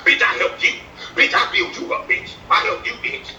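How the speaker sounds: half-whispered mock imitation; breathy mimic of the other guy's bitch; lightly voiced taunting imitation; mocking his bitch.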